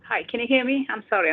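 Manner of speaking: ordinary talk, nothing marked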